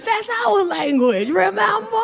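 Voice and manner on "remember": ordinary delivery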